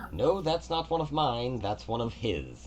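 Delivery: god Kelemvor. Acted. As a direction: speak as a gracious, orderly god. Kelemvor answers the kneeling player.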